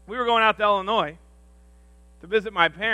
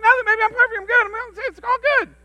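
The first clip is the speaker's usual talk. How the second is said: much higher, fast, wired voice; hyper denial; 13 semitones higher, 6 dB louder, faster than usual. Retold or imitated. Pastor, wired on caffeine, telling his wife he's fine, high and fast. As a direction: insist you're fine while bouncing. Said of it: "I'm like".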